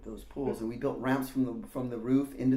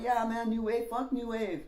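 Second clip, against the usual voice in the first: mocking Randy; 9 semitones higher than usual. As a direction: sneer at the music like a punk older kid. Randy keeps scoffing.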